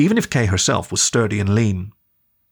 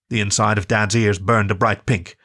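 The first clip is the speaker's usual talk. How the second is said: ordinary delivery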